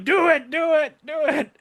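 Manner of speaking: high-pitched mock scream; retold scream. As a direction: imitate someone screaming to egg him on. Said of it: "Pepper screaming".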